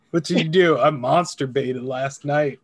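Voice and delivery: ordinary talk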